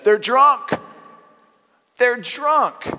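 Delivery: high, loud scoffing; mocking jeer. Mocking crowd jeers at the apostles, high and loud. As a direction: jeer at them like fools. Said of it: "the text says that some mocked and said".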